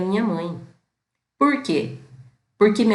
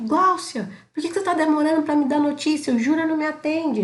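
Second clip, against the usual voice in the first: higher-pitched, worried, insistent mother's voice; imitating anxious mother; 9 semitones higher than usual. Mother calling daughter for news, imitated anxious and upset. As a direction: play the mother pressing for news, mildly frantic. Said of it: "Minha mãe já ligou puta e nervosa".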